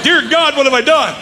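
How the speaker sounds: high, dismayed cry; mock horror. Young pastor recalls his shock at the meeting, in mock horror. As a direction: cry out, half joking, at what you have caused. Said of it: "I'm like".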